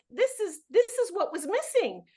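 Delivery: retelling her excited reaction to the church exposé; fired-up agreement. Retold or imitated. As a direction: preach it like a sudden revelation. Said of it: "I was like".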